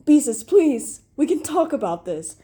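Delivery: loud plea, highest at the start; urgent, pleading shout; desperate yell. A narrator's reading. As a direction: call out begging them to stay. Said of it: "Jesus yelled desperately".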